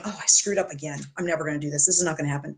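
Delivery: low, soft whiny moan; mock whine. Client moaning about a failed weekend, mock whine. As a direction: whine it like a self-pitying client. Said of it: "you just kind of whine going".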